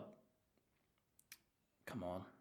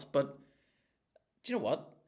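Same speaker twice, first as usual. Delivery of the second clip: ordinary delivery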